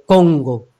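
"Congo" low and full, from the back of the mouth; voz atrás, como Congo. Shown as a demonstration. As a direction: sink "Congo" deep behind. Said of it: "está detrás". ("Congo" grave, resonando atrás)

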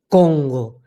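CONGO low and drawn out, from the back of the palate; deep back-of-mouth resonance on Congo; back placement. Shown as a demonstration. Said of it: "La de atrás ... de las montañas".

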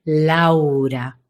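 "Laura" drawn out long and low; wide, lengthened "Laura"; ample, grand name. Shown as a demonstration. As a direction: give "Laura" breadth, stretching it slowly. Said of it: "la amplitud y la grandeza de Laura".

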